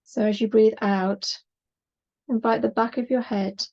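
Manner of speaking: unhurried yoga relaxation voice with long pauses; measured, calming body-scan delivery; guided meditation. Acted. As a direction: gently guide them into rest, leaving space between phrases.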